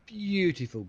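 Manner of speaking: high admiring praise; delighted beautiful. Real, not acted. Watching the salvo land, delighted. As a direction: admire the blast.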